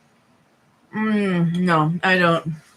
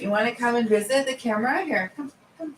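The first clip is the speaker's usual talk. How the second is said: coaxing pet voice; 7 semitones higher than usual. Real, not acted. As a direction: call the cat over gently. Calling the cat over.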